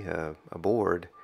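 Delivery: ordinary talk, nothing marked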